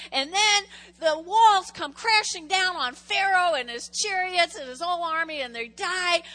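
loud, high, driving sermon voice; preaching at full force. Acted. Pastor booming the Exodus story to the congregation. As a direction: preach it out big and rising.

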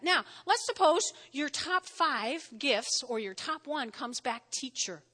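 ordinary talk

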